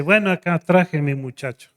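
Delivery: a hint of a relaxed visiting pastor; lightly voiced pastor. Pastor presents his son.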